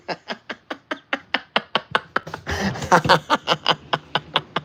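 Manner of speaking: long giggling laugh at the joke; drawn-out real laughter; gleeful laugh. Real, not acted. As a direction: laugh at your own prank. Laughing after fooling viewers.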